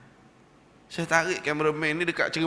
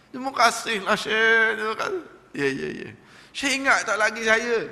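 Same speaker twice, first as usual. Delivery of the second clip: higher, louder grateful voice; eager thanks; 7 semitones higher, 4 dB louder than usual. Retold or imitated. Former smoker thanking the Syekh, higher and eager.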